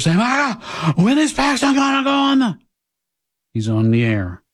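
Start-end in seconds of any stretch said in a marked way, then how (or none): 0.2-2.6 high mock-panicked wail; hammed-up desperate panic; breathless desperation. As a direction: imitate a frantic supporter in a much higher voice. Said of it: "breathless and desperate".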